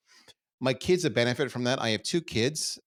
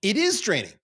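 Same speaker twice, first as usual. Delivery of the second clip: higher and louder, cutting in; emphatic burst; 10 semitones higher, 6 dB louder than usual. Real, not acted. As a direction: jump in to agree. Bursts in agreeing with host.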